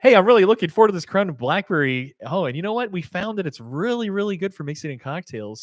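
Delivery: high, loud sponsor plug; ad read. Acted. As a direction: pitch it like a commercial. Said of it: "Is that where I'm supposed to say this".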